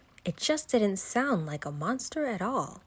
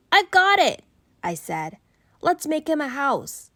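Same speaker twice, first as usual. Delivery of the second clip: much higher, much louder eureka; excited idea; 8 semitones higher, 7 dB louder than usual. Acted. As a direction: burst out with the smashing idea. Sudden idea.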